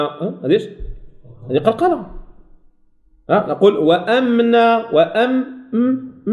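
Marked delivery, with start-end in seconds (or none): 3.8-4.9 وأمنا with the م held through the nose; nasal م. Shown as a demonstration. Teacher modelling the nasal hold in وأمنا. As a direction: let the م of وأمنا hum a moment. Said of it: "تاخذ الصوت من الخيشوم".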